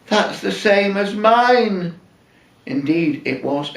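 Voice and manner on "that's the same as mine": high, slow, astonished gasp of recognition; gasping in surprise. Retold or imitated. Wife spotting her dress on the pianist, astonished. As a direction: let it dawn on you, aghast. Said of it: "Eileen gasped and said".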